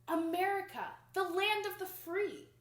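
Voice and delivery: high, loud proud announcement; grand proclamation. A narrator's reading. Reading to kids, announcing America proudly.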